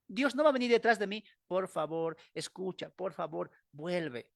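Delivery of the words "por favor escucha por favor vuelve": much softer, begging plea; whisper-soft imagined God begging him back; mock pleading God. (súplica fingida, mucho más suave)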